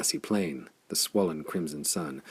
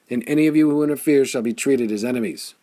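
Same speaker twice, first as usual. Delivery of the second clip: fast, higher and louder threat; roared threat; 6 semitones higher, 8 dB louder, faster than usual. A narrator's reading. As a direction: bark the warning at the crowd. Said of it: "he roared".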